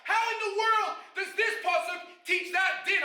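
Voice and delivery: high-pitched heated study-partner argument; impassioned screaming. Acted. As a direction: argue it fervently, voice pitched high. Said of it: "you're screaming with him".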